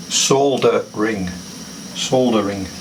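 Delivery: SOLDERING said slowly, with the L sounded; British soldering. Shown as a demonstration. Showing viewers what we call it, British pronunciation of "Soldering" with the L. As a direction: sound the L in "Soldering", slow and deliberate. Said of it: "Now we call it soldering".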